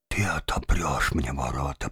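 low, hushed whisper. A narrator's reading. Father presses the janitor to open the gate, whispering. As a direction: whisper it under the breath. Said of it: "прошептал папаша ещё тише".

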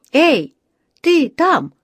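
loud, high hail; calling out. A narrator's reading. A man in the queue hails the bear, calling out. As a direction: call out sharply to someone over there. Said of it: "обратился к Паддингтону дяденька".

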